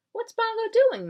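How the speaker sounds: put-on high childlike question; a small lamb piping up; little lamb voice. Baby lamb asking its mother, high and childlike.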